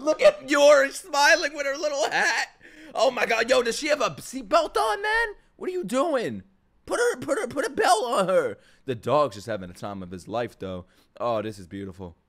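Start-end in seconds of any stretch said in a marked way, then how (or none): 0.0-2.5 high, loud fan gushing; delighted squeal. Real, not acted. Delighted by the character. 2.9-8.6 high-pitched, urgent protest; alarmed scolding. Real, not acted. Alarmed about the missing seatbelt.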